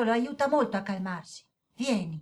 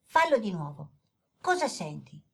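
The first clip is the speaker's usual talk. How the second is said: soft, lower, calm guiding voice; guided breathing; 4 semitones lower, 3 dB quieter than usual. Acted. Daniele teaching the boy the breathing exercise, soft guided calm. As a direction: lead him gently through the breath.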